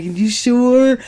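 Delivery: imitating husband's suggestive tempting tone; seductive "You sure?". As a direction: tease him as the husband, coaxing. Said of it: "say it like that?".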